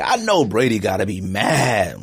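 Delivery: normal talking voice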